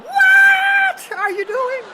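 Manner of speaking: very high, loud, slow shout through laughter; laughing shout. Real, not acted. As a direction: yell it in disbelief, cracking up. Partner's absurd play breaks him.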